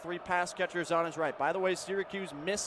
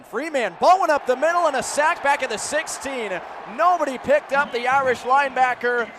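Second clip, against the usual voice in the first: louder, higher burst of play-by-play; excited sack call; 6 semitones higher, 8 dB louder than usual. Real, not acted. Announcer shouting as the linebacker sacks the quarterback. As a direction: punch the big defensive play.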